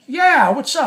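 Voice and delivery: loud, high-pitched retold greeting; friendly call-out. Storyteller walks up to Jim in the hallway, friendly call-out. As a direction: hail him eagerly from down the hall. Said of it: "now I'm with a smile".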